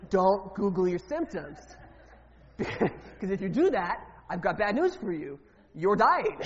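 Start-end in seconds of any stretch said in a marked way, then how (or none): 0.1-1.6 slow and louder, lean on it; deliberate, mock-serious warning. Real, not acted. Warning joke to congregation. 5.7-6.4 punchline landed loud; mock alarm. Real, not acted. Punchline of symptom joke.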